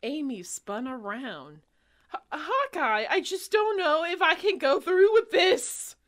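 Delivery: high, loud panicked cry; panicking. Acted. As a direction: panic to him. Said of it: "She is panicking".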